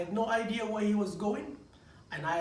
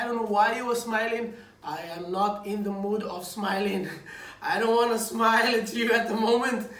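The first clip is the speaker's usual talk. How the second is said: louder, sulky retort breaking into a laugh; mock grumpy; 7 dB louder than usual. Grumpy dad snapping at smiling wife, mock anger. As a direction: play the sulking husband. Grumpy reply to wife's smile.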